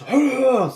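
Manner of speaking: quick high gasp of delight; retold sharp gasp. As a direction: gasp like you just spotted him. Seeing dog on floor.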